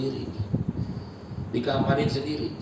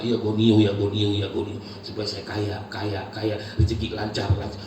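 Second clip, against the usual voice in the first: mocking greedy prayer; 6 semitones lower than usual. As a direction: ridicule the man begging for wealth.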